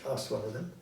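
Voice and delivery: ordinary delivery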